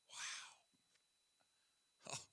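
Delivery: hushed breath of wonder; soft whispered wow; awed whisper. Real, not acted. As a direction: breathe it out in awe. Awed whisper after scripture.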